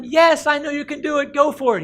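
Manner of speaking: high, loud cheering assent; excited urging. Acted. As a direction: shout him on eagerly. Duke cheering him on, acted.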